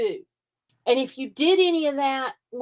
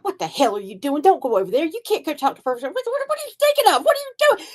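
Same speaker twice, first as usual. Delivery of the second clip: imitating ex-husband; 5 semitones higher, faster than usual. Ex-wife mimics her ex-husband's panicked scolding, mock squawk. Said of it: "I know Van would have been going".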